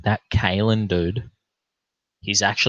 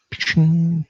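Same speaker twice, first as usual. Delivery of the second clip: mock impressed noise; 9 semitones higher, 4 dB louder than usual. Reacting to half price clippers.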